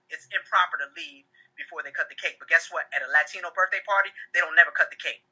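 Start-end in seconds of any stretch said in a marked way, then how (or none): none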